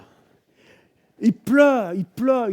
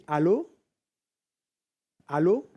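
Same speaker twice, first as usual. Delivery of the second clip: short rising questioning hello; retold calling into phone. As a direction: check if anyone is on the line. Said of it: "il crie". (allô interrogatif au téléphone)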